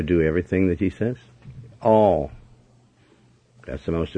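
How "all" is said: All punched out louder on its own; stress on all. Shown as a demonstration. Preacher hammering the congregation, the word All stressed hard. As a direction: hit the single word All hard and loud. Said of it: "emphasis on the word all".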